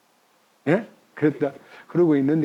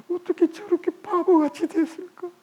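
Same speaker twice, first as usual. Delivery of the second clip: dismayed wondering; 10 semitones higher, 3 dB quieter than usual. Retold or imitated.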